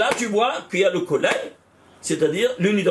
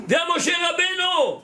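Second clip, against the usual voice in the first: much higher, slow and ringing, calling out "Viens"; fervent call; 9 semitones higher than usual. Real, not acted. Teacher voicing the call to Moshe Rabbeinu, fervent and high. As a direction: proclaim it slowly as a summons.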